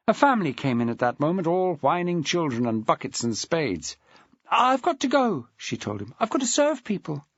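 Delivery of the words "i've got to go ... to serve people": put-on higher, hurried young woman's voice; a girl desperate to get away; Louise, flustered. A narrator's reading. Louise trying to escape Maxwell's questions, flustered.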